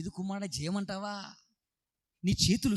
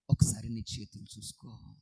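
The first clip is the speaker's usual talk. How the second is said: whispered challenge; 11 semitones lower than usual. Acted. Preacher drops to a whisper.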